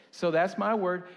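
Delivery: proud declaration of his word; emphatic. Real, not acted. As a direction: lift and punch the words my word. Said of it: "emphasis on his word".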